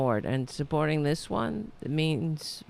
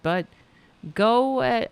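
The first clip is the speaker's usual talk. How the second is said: usual speaking voice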